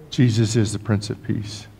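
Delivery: usual speaking voice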